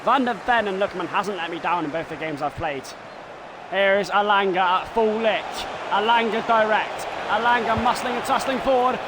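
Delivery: brisk football commentary call; live play-by-play. Acted. Gamer voicing commentary over his own match, play-by-play. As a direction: narrate the build-up like a TV commentator.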